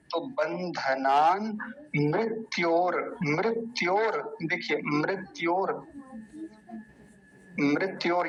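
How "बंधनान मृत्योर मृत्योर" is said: मृत्योर्मुक्षीय मामृतात् drawn out syllable by syllable; slow recitation. Shown as a demonstration. Teacher modelling the correct chant, slow. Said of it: "ये जो रे, र है ना ऊपर वाला".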